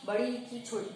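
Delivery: ordinary delivery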